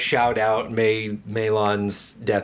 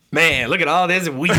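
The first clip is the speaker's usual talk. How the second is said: higher, louder swooping quote; laughing relish in a mimicked line; Carmen impression; 6 semitones higher, 5 dB louder than usual. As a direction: imitate his jarring, charismatic delivery. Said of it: "Like, the way he said it".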